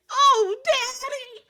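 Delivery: high, slow, loud mock cry; whiny old movie girl. Retelling the funny scene to the co-host, high mock whimper. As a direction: stretch out a high whimper like a film child. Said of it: "when she hits that".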